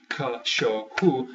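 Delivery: CACHORRO split into three slow, even beats; clapped-out syllables. Shown as a demonstration. Teacher counting syllables for learners, slow separate beats. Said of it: "use the little clapping system".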